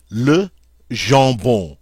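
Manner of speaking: "Le jambon" drawn out slowly, nasal with no M or N; slow it down so students hear the nasals; slow teacher demo of "Le jambon"; slow model repeat. Shown as a demonstration. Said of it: "you never hear the M".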